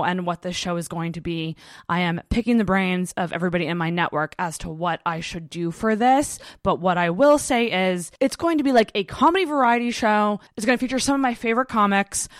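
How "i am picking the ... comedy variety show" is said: ordinary delivery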